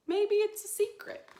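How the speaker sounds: high, loud playful read; reading to children. Acted. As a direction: tease it like a big secret. Reading book line.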